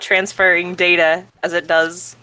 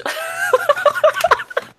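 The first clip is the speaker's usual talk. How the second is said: squealing burst of laughter; giggling fit, high; 6 dB louder than usual. Real, not acted. Breaks up laughing again.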